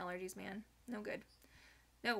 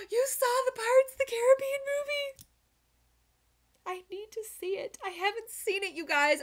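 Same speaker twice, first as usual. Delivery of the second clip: much higher, loud thrilled outburst; excited; 13 semitones higher, 10 dB louder than usual. Real, not acted. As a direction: gush to chat about the movie. Excited about Pirates movie.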